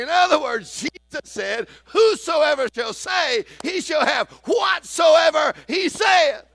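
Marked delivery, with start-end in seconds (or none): 1.9-6.4 slow, loud, high preaching of scripture. Acted. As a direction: thunder the verse out slowly like a revival preacher. Preaching scripture at full force.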